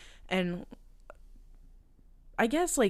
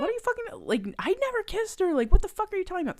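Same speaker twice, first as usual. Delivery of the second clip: frantic denial; faster than usual. Retold or imitated. Viola denies kissing her, high, fast, panicked.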